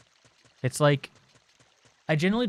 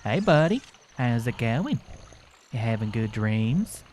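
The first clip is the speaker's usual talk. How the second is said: cooing to a pet. Real, not acted. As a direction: croon gently over someone napping. Talking to sleeping pet.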